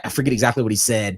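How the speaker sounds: ordinary talk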